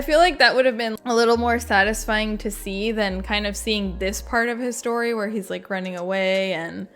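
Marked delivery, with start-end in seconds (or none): none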